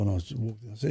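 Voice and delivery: ordinary talk, nothing marked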